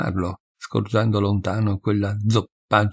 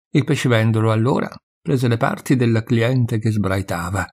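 ordinary delivery

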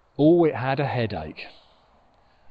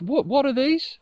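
Moon's voice; 10 semitones higher than usual. Acted. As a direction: pipe up high as the fallen Moon. Moon character voice.

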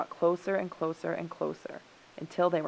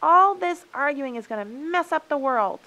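high, loud, exasperated warning; urgent raised voice; crying out; 9 semitones higher, 9 dB louder than usual. A narrator's reading. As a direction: plead loudly over the arguing. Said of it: "cried Coyote".

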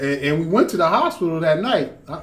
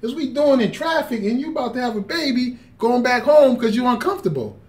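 retold yelling; 9 semitones higher than usual. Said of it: "I was yelling at her all the way up".

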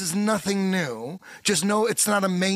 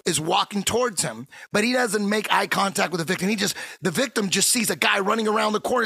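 usual speaking voice

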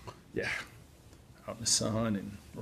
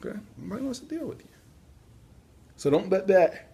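talking through a real yawn, pitch jumping much higher; words squeezed out mid-yawn; 8 semitones higher, 5 dB louder than usual. Real, not acted. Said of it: "for, for the yawning".

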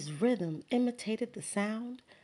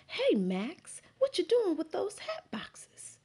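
put-on much higher, hushed kid's question; kids' voice; 11 semitones higher than usual. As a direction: call out like a curious child. Friends call out to Max.

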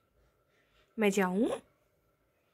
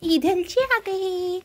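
put-on higher, slow cutesy baby voice; baby talk; 9 semitones higher, much slower than usual. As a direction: coo it slowly like talking for a toddler. Mother cooing at sulking toddler. (धीमी, ऊँची, तोतली बच्चों वाली आवाज़)

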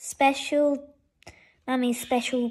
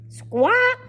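very high parrot screech; parrot squawk; 10 semitones higher, 4 dB louder than usual. A narrator's reading. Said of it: "Parrot carried on squawking".

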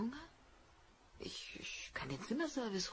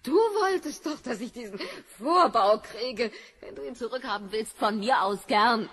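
much louder, high, furious; furious shout; 4 semitones higher, 10 dB louder than usual. Acted. Wife screaming at Biff about her surgery, furious. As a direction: hurl it back at him in a rage.

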